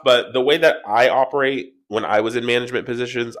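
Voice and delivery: normal talking voice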